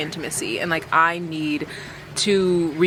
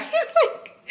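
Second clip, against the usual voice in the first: laughing. Real, not acted. Laughing at her own goal.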